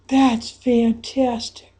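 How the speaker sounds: ordinary delivery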